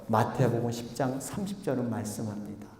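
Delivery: ordinary delivery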